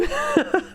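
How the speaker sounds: squeaky burst of laughter; high giggle at herself. Real, not acted. Laughs at name.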